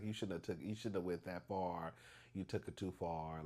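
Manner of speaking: low, soft retold scolding; muted self-reproach. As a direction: tell yourself off quietly.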